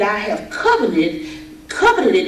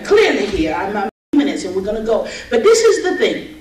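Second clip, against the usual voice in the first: normal talking voice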